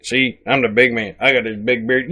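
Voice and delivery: low-pitched mocking impersonation; Jay. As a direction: drop low and play the self-important big man. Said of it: "I can do a Jay impersonation".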